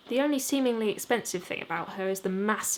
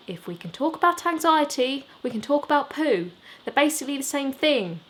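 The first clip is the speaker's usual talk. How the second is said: higher, louder playful comeback; cheeky retort; 5 semitones higher, 4 dB louder than usual. Acted. Lister teasing Rowan in the car, cheeky and loud. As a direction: toss it back with a grin. Lister jokes back, raised voice.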